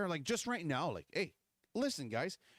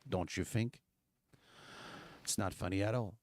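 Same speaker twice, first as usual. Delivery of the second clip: usual speaking voice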